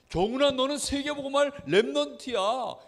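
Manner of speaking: put-on name the child's calling with conviction; proclaiming encouragement. Blessing spoken over a child. (높고 힘 있게 선포하는 말투)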